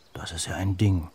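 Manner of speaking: plain reading voice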